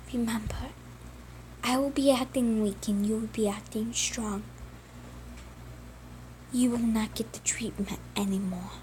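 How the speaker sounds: soft unvoiced whisper. Andy whispering the secret plan to Simon. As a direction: whisper the plan so no one hears.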